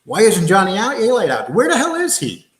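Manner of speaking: ordinary talk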